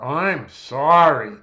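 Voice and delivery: loud, very drawn-out sarcastic "I'm sorry"; drenched in sarcasm. Shown as a demonstration. Lecturer demonstrates inflection to students, heavily sarcastic apology. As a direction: lean hard on "sorry" with heavy sarcasm, stretching it out. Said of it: "drenched in sarcasm".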